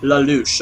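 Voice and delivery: Lelouch as luh-LOOSH, falling at the end; settled pronunciation. Real, not acted. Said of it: "I'll say it like that".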